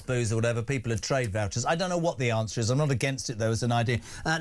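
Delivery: usual speaking voice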